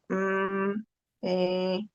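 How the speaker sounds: soft, drawn-out hums of hesitation; filler hesitation. Shown as a demonstration. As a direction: show a student stalling, quietly and level. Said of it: "besides to see".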